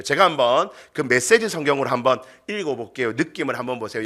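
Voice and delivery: ordinary talk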